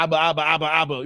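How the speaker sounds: deliberate comic stammer of nonsense syllables; mock stuttering. Real, not acted. Streamer to chat, fake stuttering in mock shock. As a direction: babble abba abba like you lost your words. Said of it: "you got me stuttering right now".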